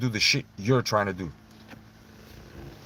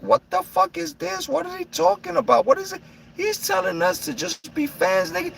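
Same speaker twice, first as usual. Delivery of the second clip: very high incredulous voice; baffled disbelief. Retold or imitated. Reading the news after work, high baffled disbelief. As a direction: squeak out total confusion.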